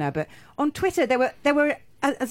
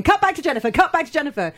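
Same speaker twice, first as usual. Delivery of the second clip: high, louder, urgent repeated order; frantic studio gallery; 7 semitones higher, 4 dB louder than usual. Retold or imitated. Studio crew demanding a cut back to Jennifer, frantic. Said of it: "the studio's going".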